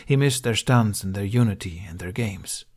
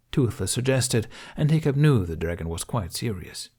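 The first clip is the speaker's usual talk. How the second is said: plain reading voice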